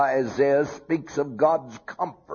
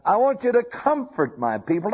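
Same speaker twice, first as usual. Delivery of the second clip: higher and faster, pressing the charge; God's urgent plea; 8 semitones higher, faster than usual. Acted. Preacher voicing God urging Isaiah to comfort, pressing fast. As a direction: urge it quickly like a command from above. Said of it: "and he said".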